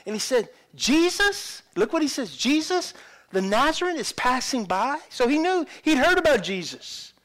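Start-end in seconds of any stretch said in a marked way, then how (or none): 0.8-1.6 high, rising disbelief; astonished question. Acted. Blind beggar hearing who is passing by, astonished. 2.4-5.0 high-pitched, amazed asking; astonished question. Acted. Blind beggar realising who passes by, astonished.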